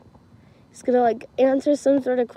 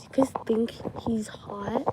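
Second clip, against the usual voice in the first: hushed question; 8 dB quieter than usual. Retold or imitated. Friend's question quoted in hushed voice.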